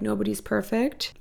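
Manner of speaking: ordinary talk, nothing marked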